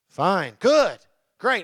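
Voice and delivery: loud, bright-on-the-surface fine good great; breezy social answer. Retold or imitated. Pastor mimicking a stock answer, loud and breezy. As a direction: toss it off like a canned reply.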